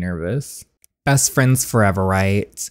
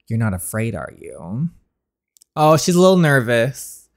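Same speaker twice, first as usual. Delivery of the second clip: normal talking voice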